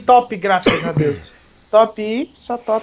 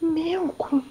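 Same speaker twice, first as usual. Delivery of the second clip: whisper; 7 semitones higher, 9 dB quieter than usual. Real, not acted. Muttered jab at teammate.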